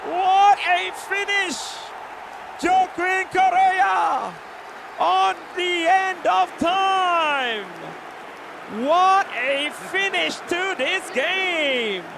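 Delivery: loud, slow goal celebration; excited shouting. Real, not acted. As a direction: shout it out in awe.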